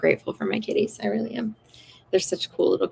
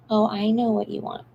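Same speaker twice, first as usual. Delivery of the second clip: ordinary talk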